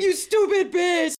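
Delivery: high loud mock yell of an insult; furious shout. Co-host voices the boyfriend screaming at his girlfriend. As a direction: scream it at her in mock rage.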